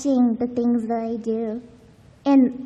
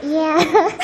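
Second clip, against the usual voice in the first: laughing; 5 semitones higher, 9 dB louder than usual. Real, not acted. Laughing shout.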